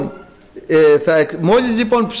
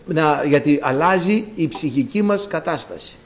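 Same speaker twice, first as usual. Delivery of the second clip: ordinary talk, nothing marked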